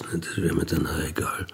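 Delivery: quick, hushed throwaway whisper; whispered aside. Acted. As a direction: toss it off under your breath, fast. Beiläufige geflüsterte Nachbemerkung. (schnell hingeflüstert)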